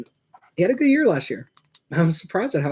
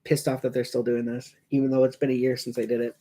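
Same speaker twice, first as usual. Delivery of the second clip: normal talking voice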